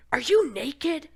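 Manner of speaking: high, loud alarmed question; retold alarm at a naked toddler; shocked question. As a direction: catch her in the act, startled. Mom spots naked toddler.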